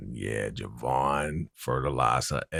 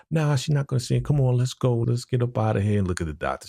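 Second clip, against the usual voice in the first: hurried Geneva voice; 7 semitones higher, faster than usual. Retold or imitated. Geneva hurrying him away.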